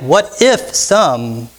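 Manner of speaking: slow What if some? with the weight on if; What if some? slowed down, if stressed. Shown as a demonstration. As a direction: draw out the hypothetical if gently. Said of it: "this little hypothetical if".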